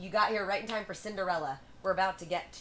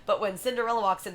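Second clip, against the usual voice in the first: plain reading voice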